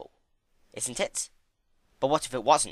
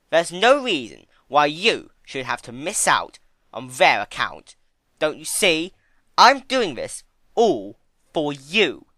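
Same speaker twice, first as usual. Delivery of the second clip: slow, much louder scornful villain; louder, drawn-out menacing voice; Discord sneering; 5 semitones higher, 10 dB louder, slower than usual. A narrator's reading. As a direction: sneer the words out.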